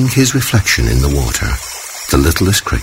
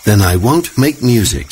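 put-on a touch of childish defiance, higher; mild defiant child voice; defiantly; 9 semitones higher than usual. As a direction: pout it out like a stubborn little cricket. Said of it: "said the littlest cricket defiantly".

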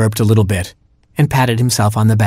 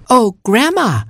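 very high, squeaky exclamation; emotional cry; 13 semitones higher, slower than usual. A narrator's reading. Robin finds Grandma alive, high urgent cry.

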